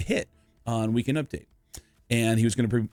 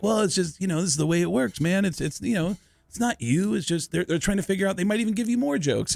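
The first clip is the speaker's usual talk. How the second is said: retold placating; 6 semitones higher than usual. Retold soothing of an irritated comic.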